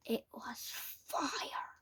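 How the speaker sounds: hushed, slow whisper of dread. A narrator's reading. Narrator whispering the grim reveal to listeners. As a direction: breathe it out like a dark secret.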